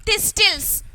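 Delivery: "thistles" with the middle T pronounced, this-tils; wrongly sounded T. Shown as a demonstration. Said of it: "Did I say thistles?".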